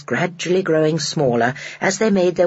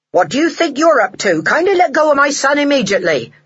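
louder, higher indignant man; angry father; 9 semitones higher, 5 dB louder than usual. A narrator's reading. Father scolding the old lady who grabbed his son, louder and higher. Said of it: "an angry man's voice".

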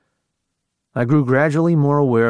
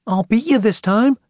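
put-on much higher, cheeky grinning boy; 11 semitones higher than usual. A narrator's reading. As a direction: tease it with a grin. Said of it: "grinning as he held up".

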